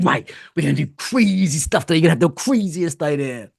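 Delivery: a touch of wild excitement; mildly manic ideas coach; crazy coach. Retold or imitated. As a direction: pitch the wildest idea with glee. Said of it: "human L S D".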